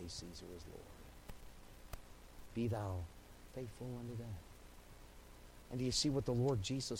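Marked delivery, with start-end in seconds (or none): 0.0-0.8 much softer and lower; almost under the breath; quoting the pagan oath in a hush; hushed forbidden words. Acted. 2.5-4.4 soft reverent quote. Acted. Verse repeated softly.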